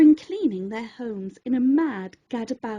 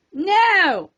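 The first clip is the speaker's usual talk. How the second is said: a sharp cry, louder; cried out; 4 semitones higher, 6 dB louder than usual. A narrator's reading. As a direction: stop him with a loud cry. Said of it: "cried the prof".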